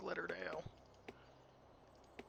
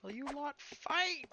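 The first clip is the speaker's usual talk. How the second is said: exasperated plea; 13 semitones higher, 3 dB louder, slower than usual. Real, not acted. Yelling at own troops.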